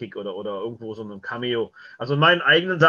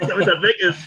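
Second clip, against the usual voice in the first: says it through a laugh; giggling while finishing the joke; 5 semitones higher, 6 dB louder than usual. Real, not acted. Guest laughing at his own Gottschalk joke.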